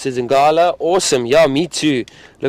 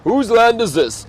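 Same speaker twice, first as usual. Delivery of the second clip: higher, roaring lion claiming land; lion's roar voice; 9 semitones higher, 3 dB louder than usual. Retold or imitated. Guide repeats the lion's words with a higher roaring voice. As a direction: call it out like a lion. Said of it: "That's what a lion says when he's roaring".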